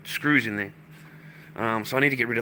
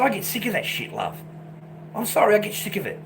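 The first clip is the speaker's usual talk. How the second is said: higher, exasperated telling-off; fed-up retort; 8 semitones higher than usual. Retold or imitated. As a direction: snap back, sick of hearing it. Telling off the woman.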